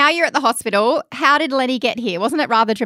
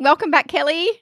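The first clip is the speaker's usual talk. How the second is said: normal talking voice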